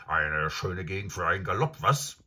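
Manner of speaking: lauter, freudig auffordernd; begeistert. Acted. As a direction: Lust auf Galopp wecken. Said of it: "bemerkte das Pferd". (Pferd lockt Shasta zum Galopp, lauter)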